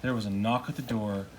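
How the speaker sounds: usual speaking voice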